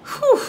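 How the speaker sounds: high, airy exhaled whew; sharp relieved puff of breath; emotional whew. Real, not acted. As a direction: blow out a breath to release the emotion. Exhales after emotional reading.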